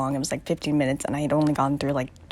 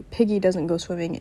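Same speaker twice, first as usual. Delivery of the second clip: ordinary talk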